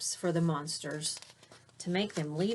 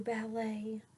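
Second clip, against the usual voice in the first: whisper; 4 semitones higher, 4 dB quieter than usual. Acted. Snoozing through ballet.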